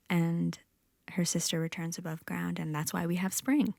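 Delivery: usual speaking voice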